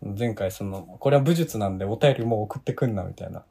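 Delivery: usual speaking voice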